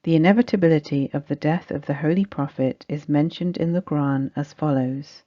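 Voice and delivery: ordinary talk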